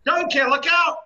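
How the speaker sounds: loud, high urgent yell; warning shout. Acted. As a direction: yell a warning across the room. Shrek warns Donkey of fireball.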